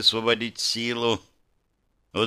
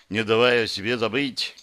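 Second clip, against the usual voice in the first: usual speaking voice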